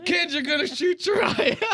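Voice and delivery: very high, hammed-up warning; comic high-pitched alarm; alarmed mock instructor. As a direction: imitate a trainer panicking at a careless kid. Mimics alarmed instructor grabbing the gun.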